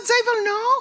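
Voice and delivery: put-on high, loud German-accented insistence; emphatic German manager imitation. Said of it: "I wanna hear your German accent".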